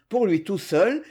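ordinary delivery, nothing marked

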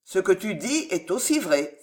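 ordinary delivery

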